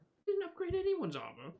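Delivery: high-pitched objection, dropping at the end; indignant protest. Acted. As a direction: squeak out a protest at the accusation. Trask protests during acted haggling.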